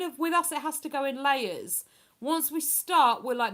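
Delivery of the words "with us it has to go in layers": ordinary delivery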